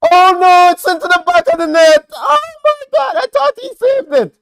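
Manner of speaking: screaming in disbelief, very high and loud; anguished shout. Real, not acted. Commentator screaming as Mexico score late. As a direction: yell it like your team just conceded. Conceding a late goal live.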